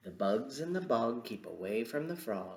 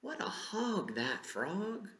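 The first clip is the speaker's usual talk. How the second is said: amazed exclaim; 11 semitones higher than usual. Acted. Exclaims at greedy frog.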